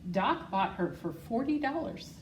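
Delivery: plain reading voice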